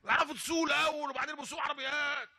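high shouting rebuke of the sheikh; angry yelling driver. Retold or imitated. Driver shouting angrily at the sheikh after the crash. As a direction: yell at him like an angry driver. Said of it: "لا، وزعيق".

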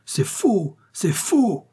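very high-pitched exclamation; outraged denial. A narrator's reading. Brothers rejecting the bandit's claim of kinship, indignant. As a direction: cry out in indignant disbelief.

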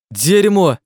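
high, loud sudden yelp; startled cry. A narrator's reading. Klazen shies from something by the wall, crying out. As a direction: cry out in shock. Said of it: "вскрикнул вдруг Клазен".

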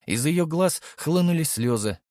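plain reading voice

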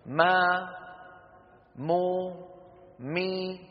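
ما، مو، مي each opening off the lips; moving meem syllables. Shown as a demonstration. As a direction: open the lips into each vowel of ما، مو، مي. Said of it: "الميم المتحركة". (ميم متحركة بتباعد الشفتين)

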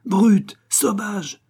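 high, loud insults; exasperated cry. A narrator's reading. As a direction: hurl the words in exasperation. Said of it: "cria madame exaspérée".

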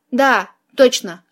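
ordinary delivery

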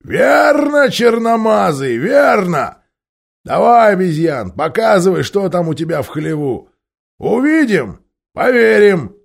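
high, loud mocking shout; loud high dwarf jeers; jeering dwarves. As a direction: taunt the ape loudly. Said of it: "гномы ответили".

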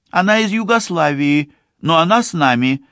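put-on high, quick declaring girl's voice; firm young woman, high and quick; man doing a woman's voice. A narrator's reading. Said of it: "заявляет Ливи".

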